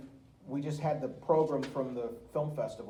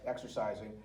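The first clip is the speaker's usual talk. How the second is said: ordinary talk, nothing marked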